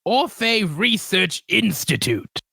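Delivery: high, slow, stretched-out character voice; shopkeeper figure's voice. Acted. Game master's shop figure names the institute, high drawn-out voice. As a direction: announce it grandly, high and slow. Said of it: "you can hear a voice coming from it".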